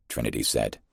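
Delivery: usual speaking voice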